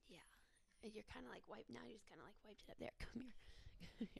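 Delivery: very soft close whisper; intimate whisper. Real, not acted. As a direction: murmur right at her ear. Wiping partner's mascara, close.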